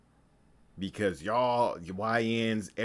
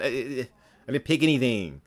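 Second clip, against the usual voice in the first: ordinary talk, nothing marked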